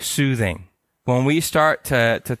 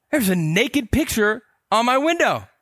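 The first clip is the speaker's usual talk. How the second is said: alarmed; 9 semitones higher than usual. Retold or imitated. Alarmed report to buddy.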